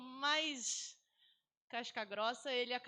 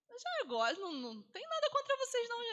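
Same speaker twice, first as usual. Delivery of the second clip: put-on much higher, louder child voice; child's voice; 12 semitones higher, 4 dB louder than usual. Teacher voicing a pupil insisting, high and loud. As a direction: sound like a kid insisting.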